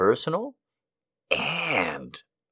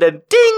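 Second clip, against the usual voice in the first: squeaky, much higher and louder ding; ping it like an elevator bell; imitated ding; 11 dB louder than usual. Comic retelling the elevator, squeaking ding.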